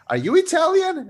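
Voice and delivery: high, loud disbelieving question; incredulous question. Retold or imitated. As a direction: imitate an astonished challenge, voice leaping up. Retold exchange, disbelieving question.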